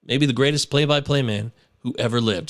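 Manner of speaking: ordinary talk, nothing marked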